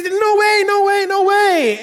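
put-on high loud shout of refusal, child voice. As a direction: shout it like a panicked boy.

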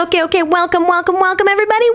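high, rising emcee patter; high-pitched rapid-fire repeated greeting; hyped-up welcome. Pretend. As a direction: build excitement like a show opener. Hyped show opening.

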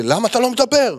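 quoting the man yelling for an answer; retold shout. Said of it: "הוא מתחיל לצעוק לי".

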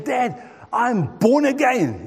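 slow, high, proud announcement to dad; a boy proudly telling his dad he's born again; eager announcement. Retold or imitated. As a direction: lean on each word like big news.

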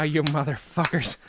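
usual speaking voice

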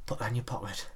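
soft coaxing inner urge; quiet inner voice. Retold or imitated. The id tempting a child, quietly coaxing. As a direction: murmur it like a sly impulse. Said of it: "it's like".

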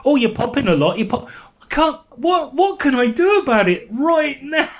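high, exasperated re-enactment; flustered protest. Retold or imitated. Podcaster re-enacting getting mic notes live, high flustered protest. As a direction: protest helplessly in a rising high voice.

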